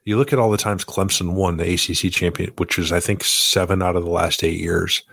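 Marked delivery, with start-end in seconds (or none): none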